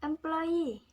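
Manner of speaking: "Employee" with final EE held high, then falling; stress on the suffix. Shown as a demonstration. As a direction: push the last syllable of "Employee". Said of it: "The suffixes need to be imposed".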